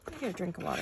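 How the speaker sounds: parched, dry-mouthed voice; talking with a dry mouth. Real, not acted. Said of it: "I got really, really dry mouth".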